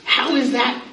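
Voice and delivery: squeaky high startled "who", then a whispered "what?"; mock shocked reaction. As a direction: act appalled by your own old post. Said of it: "I read this and I'm like".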